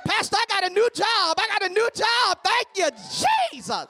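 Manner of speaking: loud, high happy shout of thanks; excited testimony. Retold or imitated. Churchgoer telling the pastor about a new job, excited shout.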